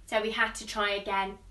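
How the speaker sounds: ordinary delivery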